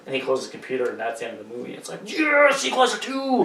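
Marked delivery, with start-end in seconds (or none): 2.1-3.5 much higher, gleeful cheer; mock excited announcement. Friend mocks the open ending, high gleeful cheer. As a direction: cheer it like a movie teaser.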